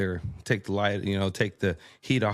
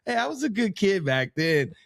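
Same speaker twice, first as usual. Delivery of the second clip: high laugh spilling into the words; 11 semitones higher than usual. Real, not acted. Man laughing off his cousin's teasing about babysitting. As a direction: laugh it off then protest through the laugh.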